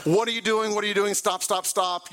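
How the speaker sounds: shouting at the driver cutting the corner, re-enacted; retold yelling. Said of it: "I start yelling and screaming".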